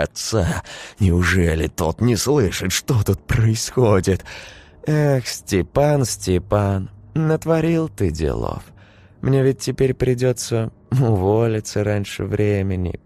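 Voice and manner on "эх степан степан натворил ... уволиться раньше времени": higher, sugary voice of a sinister nanny. A narrator's reading. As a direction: play the nanny as sweetly menacing.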